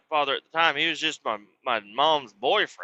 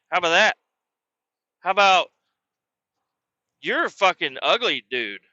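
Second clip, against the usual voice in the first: higher, louder mocking taunts; taunting yell; 4 semitones higher, 4 dB louder than usual. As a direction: jab at him with each "how about". Cussing out the stepfather.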